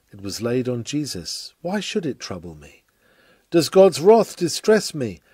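ordinary delivery

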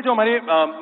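usual speaking voice

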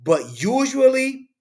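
high and slow, teasing the point; sarcastic drawn usually. Acted. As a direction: stretch it up like an eye roll.

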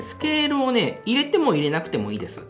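ordinary delivery

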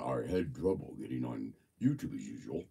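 deep voice guess. Shown as a demonstration. Host guessing how Robert's voice sounds, in a deep voice. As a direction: drop the line way down deep. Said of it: "deep or high or medium".

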